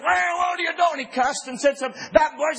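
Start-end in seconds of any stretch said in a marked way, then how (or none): 0.0-1.1 angry driver. Retold or imitated. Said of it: "he was as hot as a firecracker".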